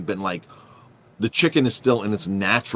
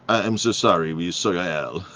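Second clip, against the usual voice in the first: imitated apologetic French waiter; French accent. French waiter apologizes about the salad dressing, French accent. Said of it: "So the waiter goes in this French accent".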